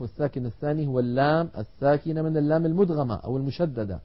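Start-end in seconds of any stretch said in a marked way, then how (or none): none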